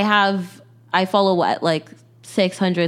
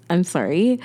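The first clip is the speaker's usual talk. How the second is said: ordinary delivery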